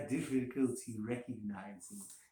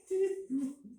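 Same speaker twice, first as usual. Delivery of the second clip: nervous high laugh; 3 dB louder than usual. A narrator's reading.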